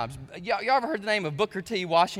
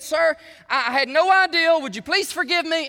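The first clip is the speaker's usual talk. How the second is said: higher, louder desperate plea for forgiveness; mortified pleading; 9 semitones higher, 8 dB louder than usual. Retold or imitated. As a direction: stammer out the apology, begging. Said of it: "she was mortified".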